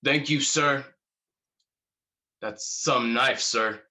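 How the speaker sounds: mock-tough young corporal; lightly acted macho politeness; best macho voice. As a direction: put on a little bravado for an officer. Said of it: "in my best macho voice".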